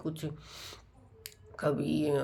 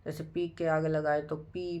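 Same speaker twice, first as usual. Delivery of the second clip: ordinary talk, nothing marked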